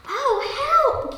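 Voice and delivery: high, alarmed storybook cry for help; gasped. A narrator's reading. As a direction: gasp it in mock panic. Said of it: "gasped Peter".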